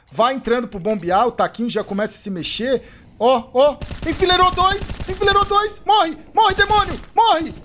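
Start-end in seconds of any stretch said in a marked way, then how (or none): 3.2-5.8 high, loud screaming celebration; ecstatic yelling. Real, not acted. Caster screaming as his team wins the round. 5.9-7.5 high, loud screams of morre; frenzied shout. Real, not acted. Caster screaming at the enemy to die.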